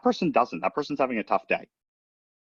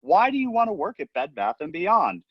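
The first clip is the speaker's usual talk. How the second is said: pushing back; 11 semitones higher, slower than usual. Retold or imitated. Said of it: "I'm like".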